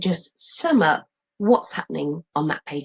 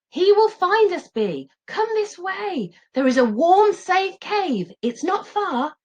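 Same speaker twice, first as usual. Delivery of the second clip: coaxing call; 13 semitones higher, 3 dB louder, slower than usual. Acted. Dad coaxing daughter.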